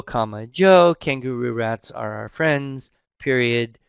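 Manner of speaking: dictating. Shown as a demonstration. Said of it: "so that the reader would then know".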